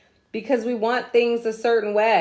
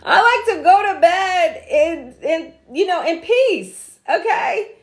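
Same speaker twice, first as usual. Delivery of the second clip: higher, louder call with a laugh; slow, raised plea for peace; calling out to son; 7 semitones higher, 4 dB louder, slower than usual. Real, not acted. Mother calling to son about gaming noise, raised call.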